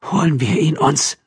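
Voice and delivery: high, cold, tense question; chilled, determined; icy voice. A narrator's reading. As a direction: ask it with icy resolve. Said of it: "Die Stimme der Beamtin wurde eisig".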